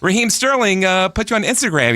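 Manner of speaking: proudly blurting out the answer; excited retelling. Retold or imitated. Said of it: "I'm like".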